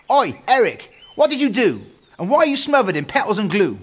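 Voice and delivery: high, loud scolding little-sister voice; unimpressed little sister. Acted. Little sister catches Eric in glue, loud scolding. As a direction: demand answers loudly, pitched right up.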